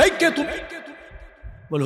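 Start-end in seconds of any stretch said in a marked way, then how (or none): 0.0-0.5 high-pitched mock-angry challenge; imitated angry teacher. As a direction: act out an irritated teacher confronting a student. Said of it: "হুজুর খুব রাগ হয়ে বলে".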